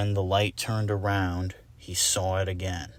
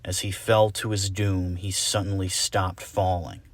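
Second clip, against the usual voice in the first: usual speaking voice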